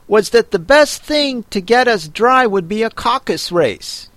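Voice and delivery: high, huffy Dodo voice; offended. A narrator's reading. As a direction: say it with wounded pride. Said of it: "said the Dodo in an offended tone".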